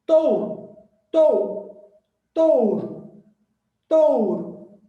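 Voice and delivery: Touro repeated loud, ou said as one gliding sound; teacher calls out Touro loudly, ou in a single opening; diphthong demo. Shown as a demonstration. Teacher drilling students on touro, loud and repeated. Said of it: "abre a boca e fecha uma única vez".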